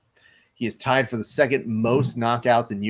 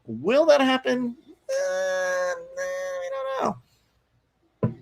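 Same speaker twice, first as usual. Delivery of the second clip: squeaky mock suspense; slower than usual. Streamer teasing chat over whether Silva wins, squeaky falsetto.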